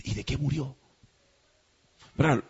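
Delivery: hushed murmur at a wake; gossip murmur. Retold or imitated. Mourner gossiping at a funeral wake, hushed. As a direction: ask it under your breath like a nosy mourner. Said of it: "Lo que suele suceder en las velas".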